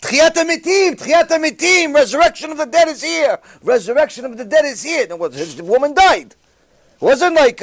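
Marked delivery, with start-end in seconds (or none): 0.0-5.1 high, loud excited cry of Tchiyat HaMetim; retold screaming. Nurses seeing the dead woman alive, shouting in amazement. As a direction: shout it like nurses who can't believe their eyes. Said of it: "They started screaming".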